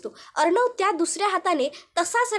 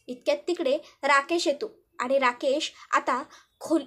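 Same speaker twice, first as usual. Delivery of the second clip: plain reading voice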